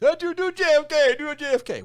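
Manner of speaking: high, loud party-guest voice imitation; mock pleading parents. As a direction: imitate tipsy parents begging for a party trick. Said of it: "My parents would get me up".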